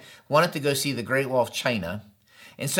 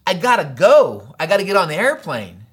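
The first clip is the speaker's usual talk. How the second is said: retold frustration; 2 semitones higher, 4 dB louder than usual. Pastor to trip leader from the cab, frustrated. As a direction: draw out the words in acted exasperation. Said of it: "I got so frustrated".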